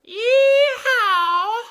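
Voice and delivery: a huge high yeehaw shout; cowboy whoop. Acted. Comma cowboy whoops as he jumps into the sentence. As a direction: whoop like a rodeo rider.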